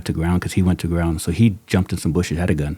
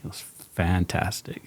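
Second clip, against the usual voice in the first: whispered praise; 8 dB quieter than usual. Real, not acted.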